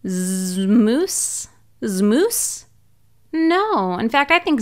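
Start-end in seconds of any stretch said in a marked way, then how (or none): 0.0-2.6 Zmoose blended slowly with a questioning rise; sounding out. Shown as a demonstration. Reader sounding out Zmoose for kids, questioning rise. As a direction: sound out Zmoose letter by letter, asking. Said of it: "let's sound it out".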